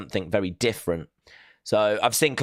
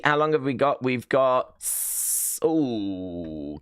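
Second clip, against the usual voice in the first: ordinary talk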